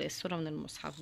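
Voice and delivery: ordinary talk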